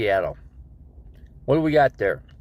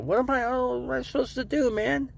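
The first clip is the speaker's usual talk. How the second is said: exasperated whine; 14 semitones higher, 4 dB quieter than usual. Retold or imitated. Exasperated reply to nurse.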